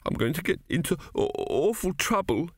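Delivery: put-on high, faltering little-boy wail; tearful dread. A narrator's reading. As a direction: stumble miserably over the words. Arthur realises goat has escaped.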